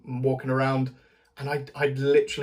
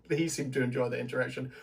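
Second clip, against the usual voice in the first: ordinary talk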